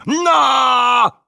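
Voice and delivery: very high, loud falling-man yell; loud cry. A narrator's reading. Old man crashing to the stone floor, loud cry. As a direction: yelp loudly as you topple over. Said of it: "mit einem lauten".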